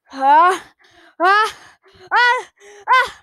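rising high sneeze build-up. Acted. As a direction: gasps climbing before a sneeze. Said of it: "Wait, I gotta sneeze".

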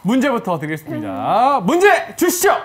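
loud, drawn-out quiz opening; game show host. Acted. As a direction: announce it like a show MC. 퀴즈 진행 오프닝. (크게 늘여 외치는 퀴즈쇼 진행자)

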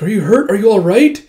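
urgent, worried check-in. Acted. Worried friend checks injuries.

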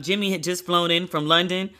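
ordinary talk, nothing marked

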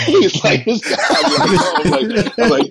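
high, loud, cracking up mid-story; laughing while talking. Real, not acted. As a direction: barely get the words out for laughing.

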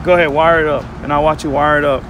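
usual speaking voice

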